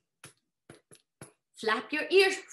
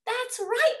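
higher, cheering storybook call; crying out; 7 semitones higher, 6 dB louder than usual. A narrator's reading. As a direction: call it out for the whole crowd. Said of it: "they all cried".

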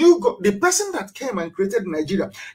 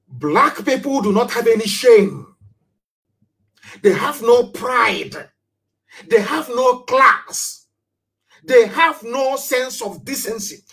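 impassioned outcry; 4 semitones higher, slower than usual. Real, not acted. Impassioned speech denouncing lack of pride.

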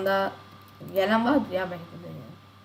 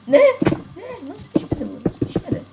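disbelief; 10 semitones higher, 4 dB louder than usual. Real, not acted. Disbelief at result.

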